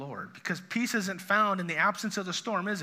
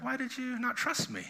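higher, softer, tender question; gentle dad; 6 semitones higher, 4 dB quieter than usual. Retold or imitated. Dad gently asking a kid who messed up, tender. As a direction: ask it like a patient father, not scolding. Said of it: "that's the tone of Jesus in this story".

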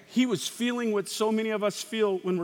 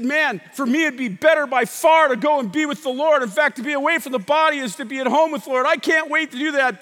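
acted eager longing; 6 semitones higher, 7 dB louder than usual. Acts out Paul's eager longing.

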